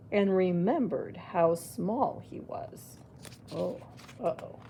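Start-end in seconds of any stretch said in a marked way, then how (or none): none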